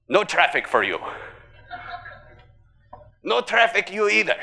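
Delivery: put-on terrible Russian accent. Putin refusing websites traffic, in a deliberately bad Russian accent. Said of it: "It's a terrible Russian accent".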